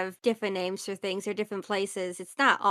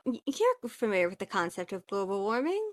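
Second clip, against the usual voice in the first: normal talking voice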